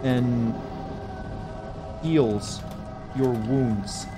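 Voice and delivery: slow, stretched words with long gaps; halting, drawn-out dictation; dictating while typing. Real, not acted. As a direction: speak each phrase as you type.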